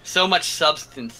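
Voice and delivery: slow, drawn-out sarcasm; sarcastic mockery. Real, not acted. Mocking a friend's praise of a kids' show, sarcastic. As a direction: stretch each word in mock admiration. Mocks friend's claim of substance.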